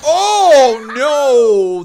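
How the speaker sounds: loud, high cry of dismay; shocked shout. Real, not acted. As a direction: yell out in horror. Watching car smashed.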